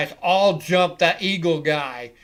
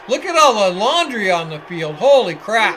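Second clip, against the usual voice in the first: louder and higher, amazed; excited outburst; 4 semitones higher, 9 dB louder than usual. Real, not acted. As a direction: shout it out in disbelief at the flags. Reacting to a field full of flags.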